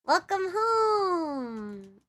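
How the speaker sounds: high, very drawn-out homecoming greeting; long, sing-song welcome; sweet welcome. Shown as a demonstration. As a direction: coo it slowly, letting the last word stretch. Demoing takes for voice pack lines.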